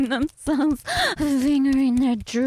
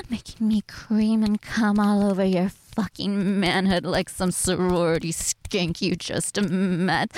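ordinary talk, nothing marked

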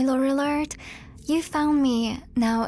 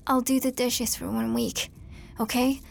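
usual speaking voice

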